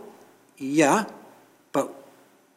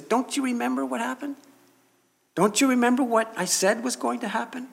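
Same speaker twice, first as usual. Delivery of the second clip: higher, fast, pleading question; pleading Jesus; 8 semitones higher, 4 dB quieter, faster than usual. Acted. Risen Jesus pressing disciples to recall, fast and higher. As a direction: urge them to remember, insistently.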